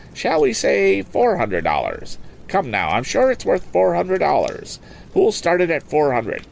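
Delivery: high, brisk auctioneer selling a boat; auctioneer's pitch. Acted. Auctioneer coaxing bids from the lakeside crowd, lively and high.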